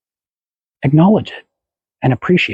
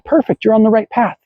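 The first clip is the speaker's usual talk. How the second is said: normal talking voice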